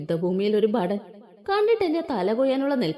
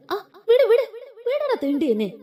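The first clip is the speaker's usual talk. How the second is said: high, loud cry to let go; pained shout; 11 semitones higher, 3 dB louder than usual. Acted. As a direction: shout in pain, pulling your arm free. Struggling against arm twist. (ഉയർന്ന, ഉറക്കെ വേദനയോടെ വിടാൻ പറയുന്ന നിലവിളി)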